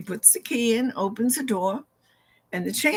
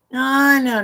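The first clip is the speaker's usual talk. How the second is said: sharp, louder protest; emphatic no; 5 semitones higher, 7 dB louder than usual. Retold or imitated. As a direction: cut him off with a firm no. Denying mind plays tricks.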